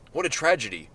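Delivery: ordinary delivery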